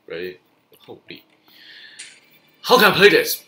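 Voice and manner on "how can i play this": loud, high cry of disbelief; exasperated yell. Real, not acted. Gamer facing overwhelming troops, exasperated yell. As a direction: yell at the screen in despair.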